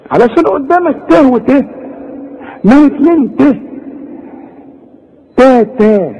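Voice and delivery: high mocking ته ته. Shown as a demonstration. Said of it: "أنا زعلان".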